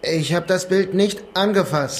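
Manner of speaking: high, loud, slow and pleading; slow innocent denial. Acted. Suspect protesting innocence to the cop, high and pleading. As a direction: drag out the denial in a high, childlike plea. Suspect denies under interrogation.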